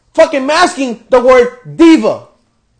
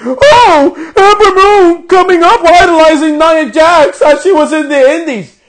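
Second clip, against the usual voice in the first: higher, louder hammed-up announcer voice, wailing; mocking commentator; 6 semitones higher, 5 dB louder than usual. Mocking the commentary team with a hammed-up higher wail. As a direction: ham up the commentary as a mock cry. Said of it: "they have the audacity to fucking say on commentary".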